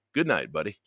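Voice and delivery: low, soft late-night sign-off; sultry radio sign-off. Acted. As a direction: purr the goodbye like a DJ. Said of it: "you gotta let me do shtick".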